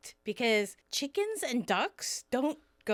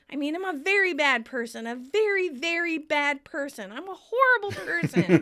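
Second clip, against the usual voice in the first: loud, raised, mock-anguished confession; acted self-reproach; 5 semitones higher, 6 dB louder than usual. Chandler confesses loving his friend's girlfriend, raised mock anguish. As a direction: beat yourself up, building to a louder finish.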